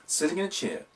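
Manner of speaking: usual speaking voice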